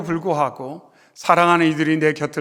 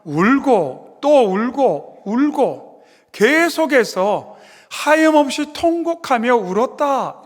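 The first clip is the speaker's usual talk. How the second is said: drawn-out lament; 5 semitones higher, slower than usual. Acted. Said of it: "원문에 들어가 보면".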